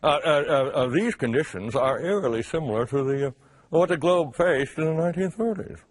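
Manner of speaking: hammed-up stammering English accent. Shown as a demonstration. Host re-reading the article as an Englishman, mock English accent. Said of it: "let me say it with an English accent".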